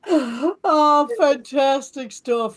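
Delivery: high laughing delight. Real, not acted. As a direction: laugh through the words. Host laughing after joke.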